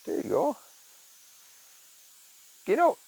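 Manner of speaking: high pet voice; high encouraging coo; coaxing the cat. Real, not acted. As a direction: coax the cat out in a squeak.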